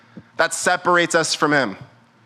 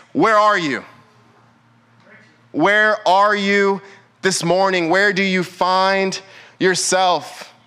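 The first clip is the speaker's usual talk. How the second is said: very slow, lingering call; slow pleading altar call; slow preached question; slower than usual. Acted. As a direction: draw each word out to the room. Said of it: "preacher calls out slowly".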